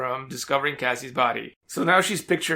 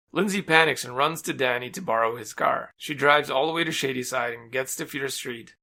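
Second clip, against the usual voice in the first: ordinary delivery, nothing marked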